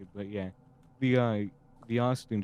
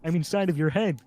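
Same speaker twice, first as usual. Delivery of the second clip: Kermit; 7 semitones higher than usual. Acted.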